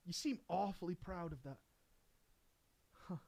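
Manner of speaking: ordinary talk, nothing marked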